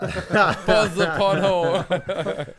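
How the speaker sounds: drawn out and cracking up; laughing announcement. Real, not acted. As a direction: announce it slowly through laughter. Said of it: "There's a, there's a title for ya".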